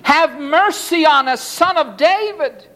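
high-pitched beggar's plea; crying aloud. A narrator's reading. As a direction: call out high for mercy. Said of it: "crying aloud".

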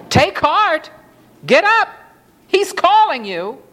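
high, rousing call; urgent encouragement. A narrator's reading. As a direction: urge him up with a high call. Crowd urges blind man to rise.

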